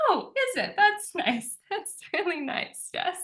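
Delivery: high, sing-song fake politeness; a knowing, amused "that's nice"; light mock sweetness; mock polite. Mock polite reply, laughing.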